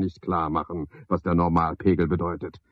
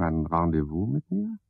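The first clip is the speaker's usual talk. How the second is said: ordinary talk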